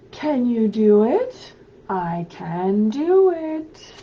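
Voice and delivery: loud, lively picture-book read-aloud; animated storybook voice calling out to children. Acted. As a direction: read it big for little kids at home. Reads picture book aloud to kids.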